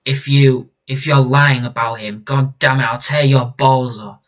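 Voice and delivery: forceful warning, mildly angry; acted threat. Parent threatening stranger over a son, forceful warning. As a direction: lean hard into the threat.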